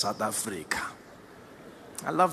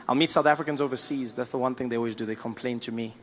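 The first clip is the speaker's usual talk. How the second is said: ordinary delivery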